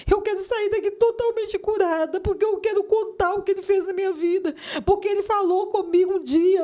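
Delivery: high-pitched, urgent outpouring; retold impassioned cry. Woman re-enacting her answer to worried deaconesses, impassioned cry. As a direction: cry out through the pain, insisting. Acted crying out during retold healing.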